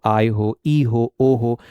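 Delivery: usual speaking voice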